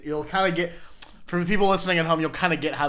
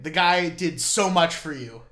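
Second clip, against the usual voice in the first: slow, louder "so much"; indignant, drawn-out "so much"; emphatic reproach; 2 semitones higher, 6 dB louder, slower than usual. Real, not acted. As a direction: lean hard on "so much". Scolding friend over unfair goldfish payment.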